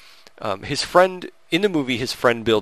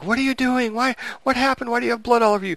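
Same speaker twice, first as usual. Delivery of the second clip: much higher, alarmed imitated girlfriend voice; girlfriend's panicked questions; 13 semitones higher, faster than usual. Girlfriend chasing her bloodied boyfriend, high panicked imitation. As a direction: play the frightened girlfriend, pitched way up. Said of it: "and she's like".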